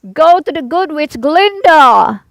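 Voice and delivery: slow, high, loud call from a rising balloon; calling from afar. A narrator's reading. Wizard calls down to Dorothy from the balloon, loud and slow. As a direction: call it out long across the distance. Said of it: "called the wizard".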